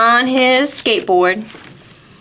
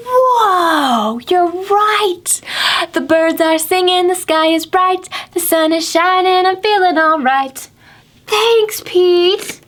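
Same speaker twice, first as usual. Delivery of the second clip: high whoa, then a happy lilt; excited alligator; 9 semitones higher than usual. Acted. Alligator thanking Pete for the magic sunglasses, high and happy. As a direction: marvel at the change.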